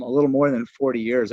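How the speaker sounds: normal talking voice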